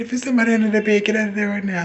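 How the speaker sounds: high, loud, fast command; high, loud, fast mock stepmother order.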